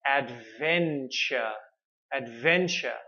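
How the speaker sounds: adventure slowly, every syllable clear; slow demo; slow model word. Shown as a demonstration. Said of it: "So again, adve".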